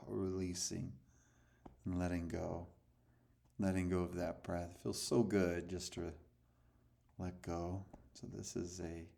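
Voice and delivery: calm breathing coach voice; guided meditation. Acted. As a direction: ease them into letting go. Leads a breathing exercise.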